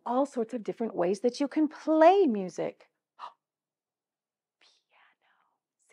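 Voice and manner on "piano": whispered Piano, soft and breathy; hushed whispered Piano. Shown as a demonstration. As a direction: whisper it to show quiet. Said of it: "Say that word with me".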